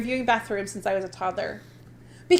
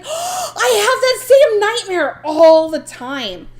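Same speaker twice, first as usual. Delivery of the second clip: slow, loud, higher, drawn out; excited recognition; 7 semitones higher, 8 dB louder, much slower than usual. Retold or imitated. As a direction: gasp and stretch it in disbelief.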